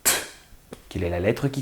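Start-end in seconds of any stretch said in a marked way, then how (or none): 0.0-0.1 "t" as a short voiceless tap, no vowel; isolated t sound. Shown as a demonstration. Teacher sounding out the start of ture, lone "t". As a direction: make just the consonant "t", quick and crisp. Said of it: "J'entends le son".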